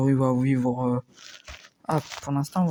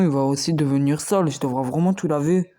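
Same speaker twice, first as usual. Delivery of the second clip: ordinary delivery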